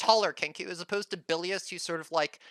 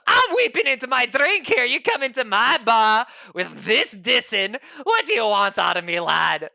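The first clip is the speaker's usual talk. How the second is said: put-on higher, louder blubbering drunken shout; drunk barkeep rant; 6 semitones higher, 6 dB louder than usual. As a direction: wail and rage over your drink.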